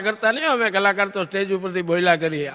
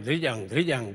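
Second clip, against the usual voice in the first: low imitated dhol beats; drum sound imitation; 9 semitones lower, 8 dB quieter than usual. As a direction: mimic the dhol. (ઢોલના અવાજની નકલ)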